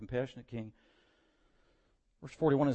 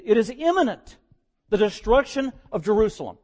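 louder, higher warning swelling up; urgent preaching; 7 semitones higher, 9 dB louder than usual. Acted. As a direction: build urgency toward the coming destruction.

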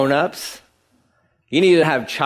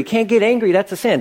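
higher, mock-pious objection; imitating a preachy objector; 6 semitones higher, faster than usual. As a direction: parrot the wrong view with a sneer. Voicing a mistaken objector.

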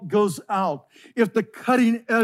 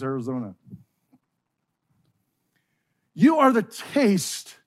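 preaching at full force; 4 semitones higher than usual. Acted. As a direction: build each "You are" into a ringing declaration. Rising climax of the sermon.

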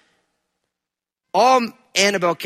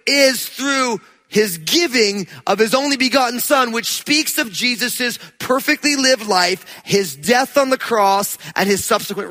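forceful preaching; 4 semitones higher, slower than usual. Acted. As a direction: drive the warning home to the congregation. Preaching climax.

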